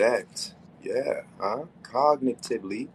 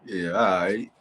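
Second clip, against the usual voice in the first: normal talking voice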